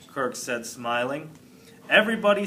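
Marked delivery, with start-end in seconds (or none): none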